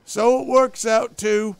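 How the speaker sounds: high, loud, drawn-out figuring; slow reckoner. Acted. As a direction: announce the sum slowly. Stubbs slowly working out the sum.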